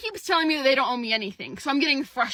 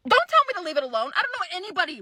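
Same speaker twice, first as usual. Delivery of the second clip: louder, fast, angry pushback; heated retort; 7 semitones higher, 5 dB louder, faster than usual. Real, not acted. Telling the recruiter off, louder and fast. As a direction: snap it back at him, fired up. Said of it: "I'm getting pretty heated".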